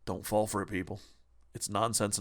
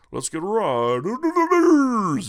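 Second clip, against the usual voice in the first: high, loud, slow sneering parody of the guy; mocking voice; 9 semitones higher, 8 dB louder, slower than usual. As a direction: ham it up like a smug show-off. Mocking the dude's attitude.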